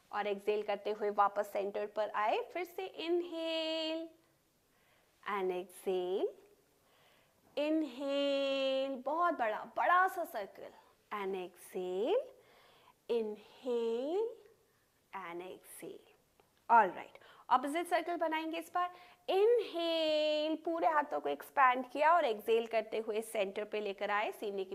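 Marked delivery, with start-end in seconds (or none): 2.5-9.0 long held vowels, rising and falling with the breath; drawn-out breath cues. Real, not acted. Yoga teacher pacing shoulder circles, drawn-out cues. 11.1-16.1 very slow, held vowels; drawn-out breath cues. Real, not acted. Yoga teacher pacing shoulder circles, drawn-out cues. 19.3-20.5 high, long-held vowel; drawn-out breath cue. Real, not acted. Yoga teacher starting the opposite circle, drawn-out cue.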